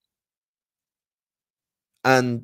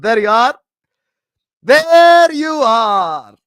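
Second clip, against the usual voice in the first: high and loud, last one stretched slowly; triumphant taunt; 9 semitones higher, 11 dB louder, much slower than usual. Real, not acted. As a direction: gloat like you just won the argument. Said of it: "triumphant taunt".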